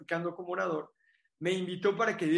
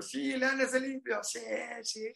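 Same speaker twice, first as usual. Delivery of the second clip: excited cheering; 4 semitones higher than usual. Retold or imitated. Retold self cheering at seminar.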